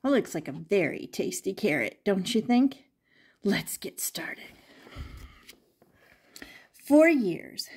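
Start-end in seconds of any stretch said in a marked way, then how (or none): 3.4-4.4 a secret whisper to begin; hushed, conspiratorial story-time whisper. Shown as a demonstration. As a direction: breathe it to the children like a secret.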